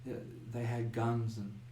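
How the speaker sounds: soft, slow, trailing off; shaken, traumatised girl. Acted. Shaken girl answering about intruders in her home, soft and slow. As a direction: answer quietly and slowly, still in shock. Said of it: "coax a few more words out of her".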